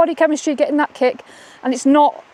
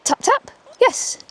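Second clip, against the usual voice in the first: high, slow, sing-song yes; high excited praise for the dog; cheery dog praise; 12 semitones higher, slower than usual. Shown as a demonstration. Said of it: "I'm gonna go".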